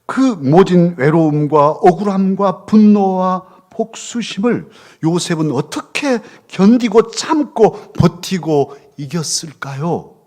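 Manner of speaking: slow, loud, high preaching build; impassioned preaching. Acted. Pastor asking how Joseph endured, impassioned slow preaching. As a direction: pour it out like a preacher at full force.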